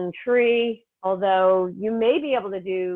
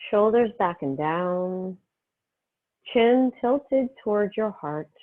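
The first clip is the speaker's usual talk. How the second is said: calm, unhurried meditation voice with long pauses; guided meditation; 2 semitones lower than usual. Real, not acted. Yoga teacher easing students into breath and healing energy, guided meditation. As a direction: settle them gently into the breath, phrase by phrase.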